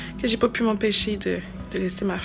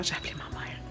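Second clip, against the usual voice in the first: whisper; 4 semitones lower, 7 dB quieter than usual. Real, not acted. Confessing she called mom.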